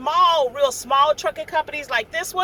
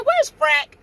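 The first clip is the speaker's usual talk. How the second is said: playful; 3 semitones higher, 4 dB louder than usual. Retold or imitated. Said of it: "just playing".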